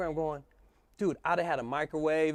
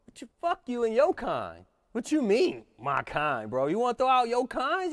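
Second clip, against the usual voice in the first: indignant outburst; 8 semitones higher than usual. Retold or imitated. Speaker fuming about the guard's remark, indignant outburst. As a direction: throw his words back at him. Retelling outrage at racist remark.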